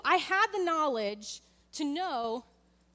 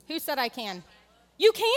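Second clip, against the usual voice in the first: normal talking voice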